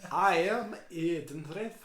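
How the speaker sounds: slow, clipped mild Scottish; a softened Scottish lilt; light Scottish accent. Acted. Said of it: "I gotta do Eden's voice, but, like, lighter a bit".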